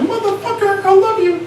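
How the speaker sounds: épouse qui hurle en anglais, voix beaucoup plus aiguë; cri aigu de la femme. Retold or imitated. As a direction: crier comme une femme déchaînée. Femme qui crie derrière. (la femme crie derrière lui, voix très aiguë)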